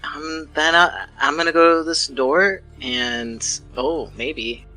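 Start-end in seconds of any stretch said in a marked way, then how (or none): none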